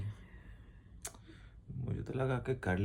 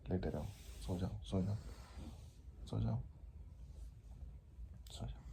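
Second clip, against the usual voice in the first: softer, gentle lulling; soothing; 11 dB quieter than usual. Real, not acted. Husband soothing his sick wife to sleep, soft and gentle. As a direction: lull her back to sleep.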